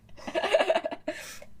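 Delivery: unvoiced high giggle; high breathless laugh. Real, not acted. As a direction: let a giggle escape, high and airy. Laugh after reading excerpt.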